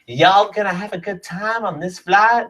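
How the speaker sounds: Southern US accent, high, mock air stewardess; a drawling Southern US air stewardess greeting passengers. Southern US flight attendant asking passengers if they're having a good time. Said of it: "they were all like".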